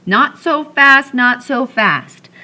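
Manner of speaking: slow, wagging-finger warning; slow him down like a stern elder; admonishing. A narrator's reading. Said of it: "admonished Henry".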